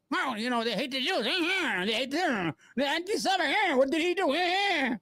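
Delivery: high, soft mocking whine of a rival; mocking whiny opponent. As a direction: squeak it out to ridicule him. Mocking opponent Wick's whining.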